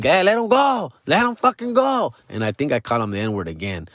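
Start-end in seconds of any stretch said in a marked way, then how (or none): 0.0-2.1 higher, heated demand; drunk yelling. Retold or imitated. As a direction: yell at your friend to let go.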